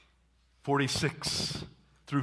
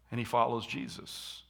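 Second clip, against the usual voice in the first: ordinary talk, nothing marked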